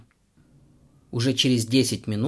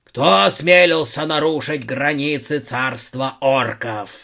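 slow, high orc growl, loud; growling orc guard; 4 semitones higher, 6 dB louder, slower than usual. Acted. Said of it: "прорычал он". (рычащий орк-охранник)

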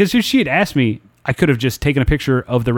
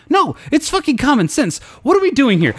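much higher, exasperated disbelief; exasperated retort; 14 semitones higher than usual. Retold or imitated. Snapping back at his mother-in-law, high and exasperated. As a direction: throw up your hands vocally.

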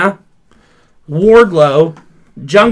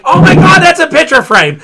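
shocked outburst; 11 semitones higher, 5 dB louder than usual. Retold or imitated. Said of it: "I was like".

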